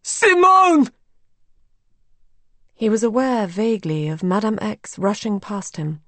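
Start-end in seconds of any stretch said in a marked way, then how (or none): 0.0-0.9 high, loud, drawn-out shout; anguished cry. A narrator's reading. Raoul bound and helpless, crying out to Simone. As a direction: scream the name in desperation. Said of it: "cried Raoul".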